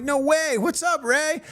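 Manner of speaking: high-pitched happy amazement; delighted greeting. Retold or imitated. Comic runs into Ray years later, delighted greeting.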